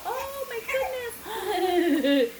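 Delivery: high laughing cry; laughing exclaim. Real, not acted. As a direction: burst out laughing. Laughing outburst.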